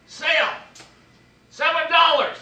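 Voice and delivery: high, loud call across the stall. Retold or imitated. Wife calling to the Mexican ladies, high loud call. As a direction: shout the price to the sellers.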